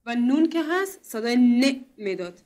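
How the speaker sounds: نون and ن said loud and slow; emphatic teaching. Shown as a demonstration. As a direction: drive the point home to the class. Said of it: "teacher stresses the letter".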